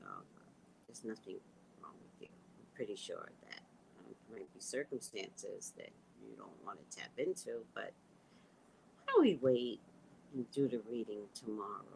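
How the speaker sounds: soft, whispery calm to keep her temper; soft soothing voice. Retold or imitated. Reader soothes a client in public, soft and calm. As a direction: hush it down and stay sweet to the client. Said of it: "I swear I was talking just like this".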